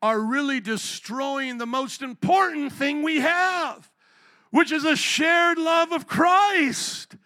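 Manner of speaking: high, loud preacher's cry, stretched out; building to an emphatic pulpit shout; impassioned preaching. Real, not acted. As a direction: hammer the point home at full voice. Preacher hammering home his main point.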